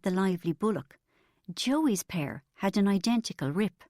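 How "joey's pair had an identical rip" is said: plain reading voice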